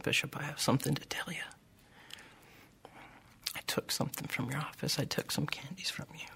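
whispered confession, fast at first; timid whisper. Retold or imitated. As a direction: confess it under your breath, afraid. Frightened boy confesses to bishop.

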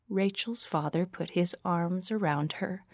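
ordinary delivery, nothing marked

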